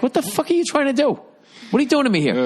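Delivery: exasperated mock rant. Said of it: "It's like".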